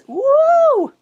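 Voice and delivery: very high, loud whoop; cheering woo. Real, not acted. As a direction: whoop to pump up the class. Feeling the heat, cheering.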